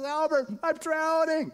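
high, panicked cry for help; retold drowning cry. Drowning husband calling to Albert, a high mock panicked cry. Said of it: "he shouts out".

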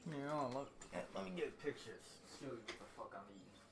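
very soft, fast muttering; muttered. Real, not acted. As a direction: mumble it fast off-mic. Muttering away from the mic.

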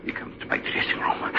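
put-on hushed whisper in an Italian accent. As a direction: breathe it out in secret. Bertini hushes his account.

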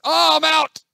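high, loud yell of defeat; loud, high-pitched outburst; dismayed shout. Real, not acted. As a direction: cry out as you get knocked out. Knocked out of the game.

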